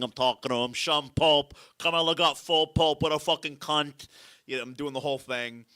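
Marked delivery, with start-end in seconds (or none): 0.7-4.1 raised, loud mobster impression; Tony Soprano. Comic showing celebrities his act, Tony Soprano impression. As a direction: whip out a loud mob-boss voice. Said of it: "I do the Tony impression".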